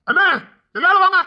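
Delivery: high, insistent calling out; shouting for family. Acted. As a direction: summon everyone with a yell. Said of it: "கத்தாதீங்க".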